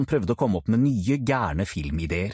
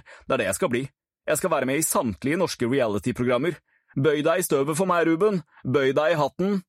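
put-on brash show-off boy, a bit higher; boastful Kent; 7 semitones higher than usual. Cocky Kent boasting to Ruben about becoming famous. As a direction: brag like a would-be celebrity.

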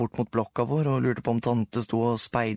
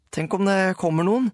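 put-on worried boy; 9 semitones higher, 4 dB louder than usual. A narrator's reading. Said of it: "sa jeg".

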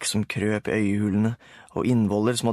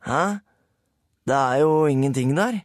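Rulle puzzled; 4 semitones higher, 4 dB louder than usual. A narrator's reading. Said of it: "svarte Rulle".

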